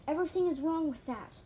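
high, loud protest short of a scream. A narrator's reading. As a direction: cry out in objection. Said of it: "I screamed".

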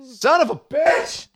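high, slow hammed-up yell of outrage; mock outrage. Host hams up fury at Ciampa's entrance, high slow mock yell. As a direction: ham up the fury at the heel.

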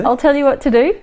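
chirpy high Aussie reassurance; breezy Aussie bloke offering help; aussie tradesman. Retold or imitated. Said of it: "would step in and go".

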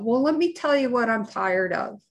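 ordinary talk

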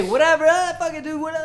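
high, loud mock careless voice; careless tattoo artist. Friend mocking a careless tattoo artist, high and loud. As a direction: toss it off like a lazy tattoo artist.

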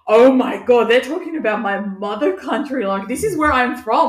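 high, loud emotional gush; overwhelmed. Retold or imitated. Retelling crying on the plane over Fiji, high and loud. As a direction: burst out as if overcome with pride.

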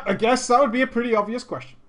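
ordinary delivery

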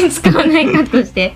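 giggling, trying not to laugh; stifled giggles. Real, not acted. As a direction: hold back a laugh while talking. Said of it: "笑いをこらえている間は全カットして".

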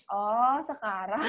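slow, drawn-out disbelieving repeat; incredulous echo. Retold or imitated. Repeating the aunt's "now" back to her, slowly and incredulous. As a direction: stretch it out in disbelief.

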